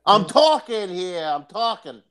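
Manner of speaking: high, drawn-out New York protest; hammed-up indignant New Yorker; New York accent. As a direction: lean hard into the New York vowels. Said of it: "You sound so New York".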